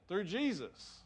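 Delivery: "Jesus" repeated deliberately, softly falling; emphatic repeat. Real, not acted. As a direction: let "Jesus" land as a measured repeat. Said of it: "I'll say that again".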